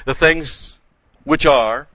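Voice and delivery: "the things which are" lifted high and loud; stressed reading. Shown as a demonstration. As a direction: lean hard on "which are". Preacher stressing present tense in verse.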